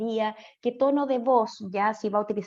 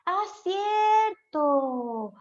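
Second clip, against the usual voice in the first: high, very slow "oh, right"; drawn-out realisation; 9 semitones higher, 4 dB louder, much slower than usual. Real, not acted. Teacher grants a student's reminder, drawn-out realisation. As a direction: stretch it out high as it dawns on you.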